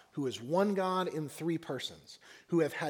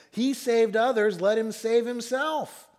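raised, louder scoffing voice; 4 semitones higher, 6 dB louder than usual. Acted. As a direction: jeer like rulers mocking a victim. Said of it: "the rulers scoffed at him, saying".

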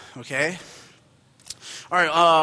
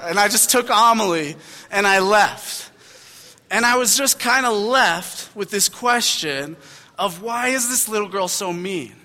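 ordinary talk

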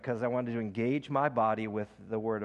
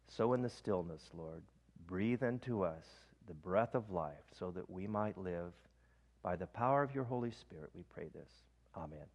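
quiet prayer; 5 semitones lower, 8 dB quieter than usual. Acted. Preacher closing the breath prayer in a soft voice. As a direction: pray softly and let it fade.

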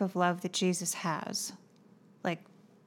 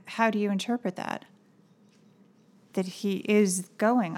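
normal talking voice

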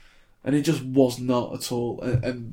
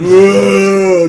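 hammed-up yelled run, much louder; mock shout; 6 semitones higher, 15 dB louder than usual. Mocking the slow-motion movie players with a shout. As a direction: shout it like a film rugby player.

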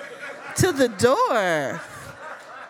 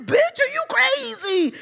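high-pitched, incredulous outburst; mock outraged boyfriend; 4 dB louder than usual. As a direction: imitate him losing it, voice way up. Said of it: "his face contorted".

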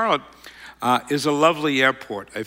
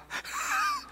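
squeaky laugh of embarrassment; embarrassed high laugh; 11 dB quieter than usual. Real, not acted. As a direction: laugh at your own bluff. Embarrassed at own bluff.

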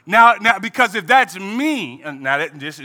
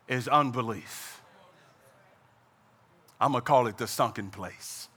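much softer, lower, intimate preaching; hushed preaching; 8 semitones lower, 9 dB quieter than usual. Acted. Preacher to congregation, hushed and low. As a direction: draw them in, low and soft.

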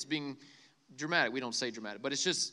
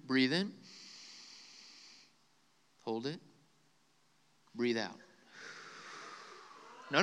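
soft, low guided breathing voice; 4 semitones lower, 3 dB quieter than usual. Acted. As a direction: lead the crowd calmly through each breath. Leads a breathing exercise.

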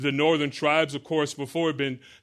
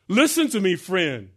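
higher, louder earnest call; urgent plea; 6 semitones higher, 5 dB louder than usual. Retold or imitated. Believer urging a friend to faith, loud and earnest. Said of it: "evangelist pleading with friend".

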